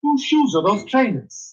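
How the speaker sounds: high, slow, confused Welsh; sing-song Welsh, sounding baffled; Welsh accent. Shown as a demonstration. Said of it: "do a good Welsh accent".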